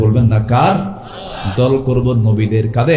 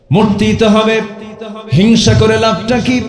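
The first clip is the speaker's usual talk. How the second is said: impassioned preaching; 11 semitones higher than usual. Acted. Preacher challenges the crowd that everyone must die. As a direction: cry out each question high and hard.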